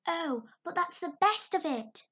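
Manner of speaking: put-on young girl protesting. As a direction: push back eagerly. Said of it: "protested Anne".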